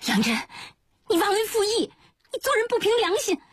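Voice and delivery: very high-pitched furious accusation; angry scolding. A narrator's reading. 季草 cursing an old friend as ungrateful, angry and high-pitched. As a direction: lash out, voice shooting far up. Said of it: "火了，指着杨真的鼻子骂道".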